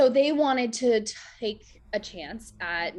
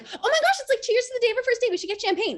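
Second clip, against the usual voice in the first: high, fast gushing excitement; bubbly high-pitched rush; excited; 7 dB louder, faster than usual. Retold or imitated. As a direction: squeal the idea out, thrilled. Excitedly pitching champagne idea.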